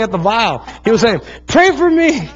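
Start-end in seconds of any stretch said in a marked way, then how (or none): 1.5-2.3 put-on high child's pleading voice; imitating crying child. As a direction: beg like a crying little boy. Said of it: "And he's crying".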